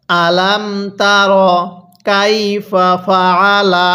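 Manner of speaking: slow, drawn-out "আলাম তার কাইফা ফাআলা"; model recitation. Shown as a demonstration. Teacher shows how to read, slow "আলাম তার কাইফা ফাআলা". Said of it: "কিভাবে পড়ব".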